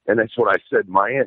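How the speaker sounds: usual speaking voice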